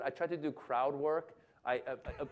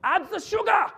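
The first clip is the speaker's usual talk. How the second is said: put-on high, loud German-accented order; yelling German chef; 13 semitones higher, 10 dB louder than usual. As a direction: bellow it like a German chef commanding his crew. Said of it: "German chef yells orders".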